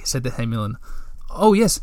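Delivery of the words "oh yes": nervous. A narrator's reading. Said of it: "Moomintroll continued nervously".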